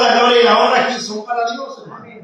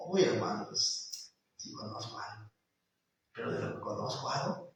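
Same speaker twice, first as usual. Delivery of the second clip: breathy near-whisper, much softer; whispered reply; 12 semitones lower, 19 dB quieter than usual. Retold or imitated. Believer admitting to the preacher, whispered. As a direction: shyly admit it under your breath. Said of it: "believer answers preacher".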